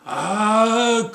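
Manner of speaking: sharp high yelp of surprise; sudden pained oh. Acted. Husband cutting his hand on a plate, surprised yelp.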